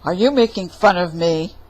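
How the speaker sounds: loud, indignant challenge; offended demand. Acted. Giant confronting Jack, loud and offended. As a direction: demand an answer, stung.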